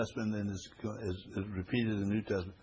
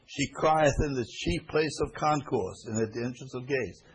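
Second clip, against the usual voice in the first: loud, higher proclaiming of scripture; scripture reading; 3 semitones higher, 5 dB louder than usual. Acted. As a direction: read the verse out like a proclamation to the congregation. Reads scripture aloud.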